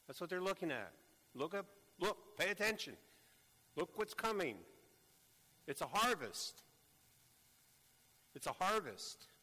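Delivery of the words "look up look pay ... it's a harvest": urgent, rising, louder urging; preacher urging disciples to look up; excited call. Acted. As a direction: point them to the crowd coming.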